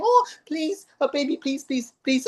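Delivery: high pleading mother's voice; mum pleading. Retold or imitated. Mother begging her crying baby, high and pleading. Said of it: "the mum's saying".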